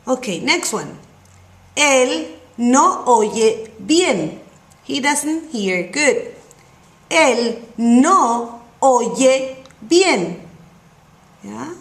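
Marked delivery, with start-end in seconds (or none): 1.8-4.4 very slow, each word clear; slow model reading. Shown as a demonstration. Teacher slowly modelling the sentence for the student. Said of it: "teacher models slow pronunciation". 7.1-10.5 very slow, each word clear; slow model reading. Shown as a demonstration. Teacher slowly modelling the sentence for the student. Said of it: "teacher models slow pronunciation".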